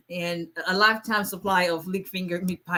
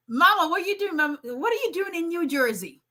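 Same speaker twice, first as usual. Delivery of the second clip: high, delighted call to Mama; surprised; 9 semitones higher than usual. Real, not acted. Greeting her mom in the chat, higher with surprise. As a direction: squeal it out in surprise.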